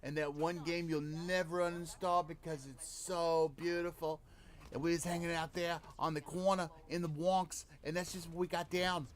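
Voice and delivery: lightly put-on Bronx tough-guy voice; a hint of a Bronx street guy telling a story; mild mock Bronx accent recalling the corner. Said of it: "bring out Bobby from the Bronx".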